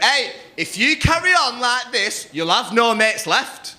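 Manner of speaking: put-on girlfriend's voice. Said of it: "She went".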